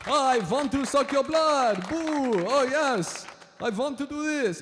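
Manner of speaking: put-on loud, high-pitched hammy Dracula accent. Comic showing the crowd the Dracula accent, high and hammed-up. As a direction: put on a big theatrical Dracula accent, stretched out slowly at first. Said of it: "The Dracula accent".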